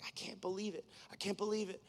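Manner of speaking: ordinary delivery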